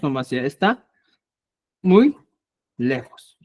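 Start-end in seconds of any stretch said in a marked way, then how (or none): 1.8-2.2 muy punched high and loud; teacher pressing on muy; stress on muy. Shown as a demonstration. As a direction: hit the word muy hard.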